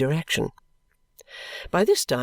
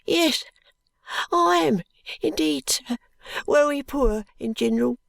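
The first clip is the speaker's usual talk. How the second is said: put-on crying boy; 11 semitones higher than usual. A narrator's reading. Ragged boy pleading with the constable, high and crying. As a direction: wail it like a desperate ragged child. Joe answers.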